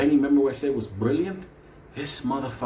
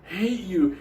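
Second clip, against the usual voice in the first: higher and louder, slow resentful; mock angry; 6 semitones higher, 4 dB louder, slower than usual. Jia Wei telling the narrator he hates them, higher, louder and slow. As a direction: spit out the hate like a sulking kid. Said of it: "he was like".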